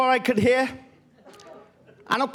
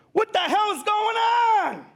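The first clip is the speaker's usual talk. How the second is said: high, slow shouted question; wife yelling; 12 semitones higher, slower than usual. Retold or imitated. Wife catching the kids, shouting from the doorway. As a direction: yell it like an angry wife. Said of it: "she's going".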